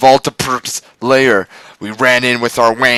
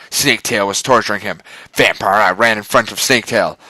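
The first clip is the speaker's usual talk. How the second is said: ordinary delivery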